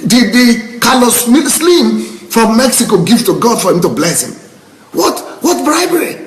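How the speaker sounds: high, worked-up rhetorical questioning; emotional. Real, not acted. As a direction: pitch soaring in indignant disbelief. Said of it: "I'm getting emotional again".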